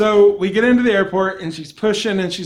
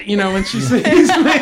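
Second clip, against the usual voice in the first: ordinary delivery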